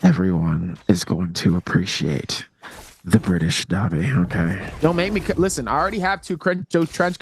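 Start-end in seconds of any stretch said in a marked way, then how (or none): 0.0-4.7 low, measured mock-British voice; put-on British accent. Caster answers a doubting chatter, deep put-on British accent. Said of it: "I did this British accent".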